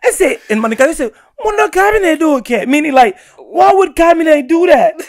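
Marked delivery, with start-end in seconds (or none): 1.4-2.7 high-pitched puzzled mother; imitated disbelief. Acting mom questioning son's deed. 3.4-4.9 high, amused mock-incredulous mom; imitated disbelief. Translating mom's incredulous question.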